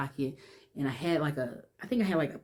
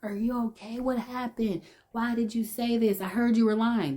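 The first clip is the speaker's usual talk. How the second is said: higher, pushy mock-concern from the cousin; cousin blurting it out; 6 semitones higher than usual. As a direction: play the nosy cousin blurting questions. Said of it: "she blurted it out".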